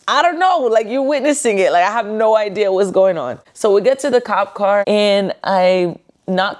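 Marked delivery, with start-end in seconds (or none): 0.1-3.4 baffled. Retold or imitated. Arrested woman answering the cop's question, baffled. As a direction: throw up an exasperated, high-pitched "no idea". Retold baffled reply to cop.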